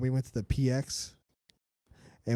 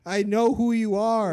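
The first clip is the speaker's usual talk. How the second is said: high, loud, fed-up mock reply; exasperated retort; 10 semitones higher, 7 dB louder, slower than usual. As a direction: snap back like you're sick of hearing it.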